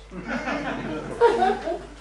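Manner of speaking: a short high burst of real laughter. Real, not acted. Speaker laughing at own joke about culling, high laugh.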